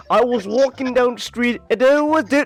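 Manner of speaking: high, blustery Trump voice pushed well up; Trump-style Herzog impression. Friend on a call imitating Trump while trying Herzog, high and blustery. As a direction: brag like Donald Trump telling a street story. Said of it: "It just ends up becoming Trump".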